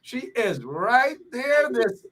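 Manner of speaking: high-pitched excited announcement; excited reveal. Real, not acted. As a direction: burst into the big reveal, pitch soaring. Guest appears during the build-up.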